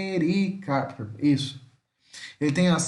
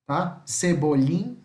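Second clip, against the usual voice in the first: ordinary talk, nothing marked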